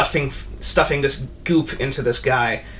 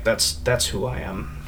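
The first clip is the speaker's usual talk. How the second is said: low quiet Sir M; 4 semitones lower, 5 dB quieter than usual. Acted. Sir M to the party, lower and quietly resigned.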